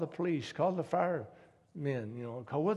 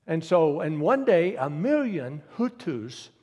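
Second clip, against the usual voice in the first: forceful preaching; 2 semitones higher, 9 dB louder, slower than usual. Acted.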